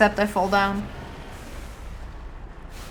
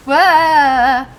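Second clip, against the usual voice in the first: startled cry; 4 semitones higher, 12 dB louder than usual. Real, not acted. Startled in combat.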